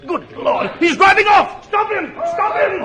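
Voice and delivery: high yelling alarm; shouting. Acted. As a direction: yell after the fleeing wagon. Wagon driver escapes.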